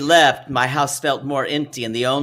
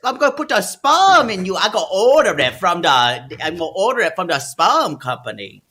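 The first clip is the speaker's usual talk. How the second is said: put-on Cajun accent mom; 10 semitones higher than usual. Voicing the fifty-three-year-old mom in a Cajun accent. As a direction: play the mother in a thick Cajun accent. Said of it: "Get used to that voice, y'all".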